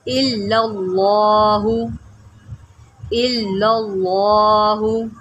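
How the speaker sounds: इल्लल्लाहु with a thick full ल and rising end; teacher showing heavy lam. Shown as a demonstration. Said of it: "लाम को मोटा करें".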